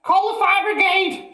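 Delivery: put-on very high, loud old woman's shriek; panicked shout. A narrator's reading. Grandma shrieking for help, very high and loud. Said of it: "she shouted suddenly".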